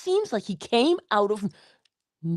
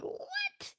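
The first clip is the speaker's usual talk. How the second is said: stunned what; 14 dB quieter than usual. Retold or imitated. Stunned reaction to stranger.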